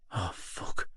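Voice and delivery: plain reading voice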